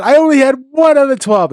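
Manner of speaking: high, loud retold protest; imitated spousal grievance; mock complaint. Retells wife's complaint.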